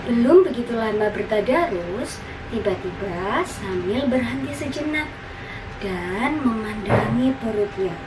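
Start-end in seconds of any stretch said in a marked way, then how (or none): none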